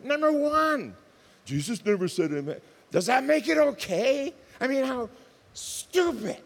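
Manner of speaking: high and loud, scornful; indignant outburst. Real, not acted. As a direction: throw it back at them in disbelief. Preacher mocking a worldview.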